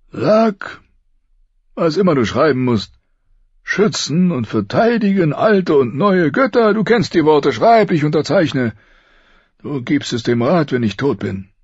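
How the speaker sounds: high, strained voice of a dying king. Acted. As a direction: push each word out through pain. Narrator voices the dying King Robert.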